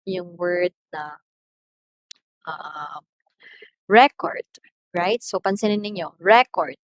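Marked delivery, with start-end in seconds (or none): 3.9-4.5 "record" with a loud REC, rising at the end; first-syllable stress. Shown as a demonstration. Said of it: "pronounced louder than the other syllables". 6.2-6.8 "record" with a loud REC, falling away; first-syllable stress. Shown as a demonstration. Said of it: "which syllable has a louder pronunciation".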